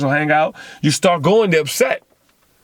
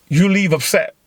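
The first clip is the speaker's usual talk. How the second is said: ordinary talk, nothing marked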